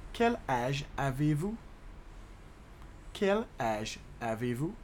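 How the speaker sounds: word by word, "Quel", "âge", "avez-vous"; measured teaching pace with small gaps; slow demonstration. Shown as a demonstration. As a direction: break the question up for learners. Teacher slows French question.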